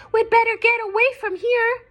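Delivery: high, loud, fast frightened squeak; squeaked in fear. Acted. Fireflies spot the web and squeak in fear, high and fast. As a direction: rush it out like tiny scared fireflies. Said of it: "squeaked in fear".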